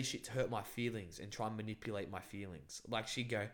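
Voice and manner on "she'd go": normal talking voice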